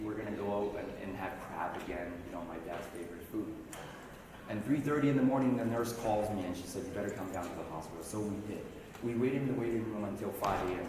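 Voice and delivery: low, soft grieving retelling; quiet and sad. Real, not acted. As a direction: hold it down, heavy with loss. Telling father's death.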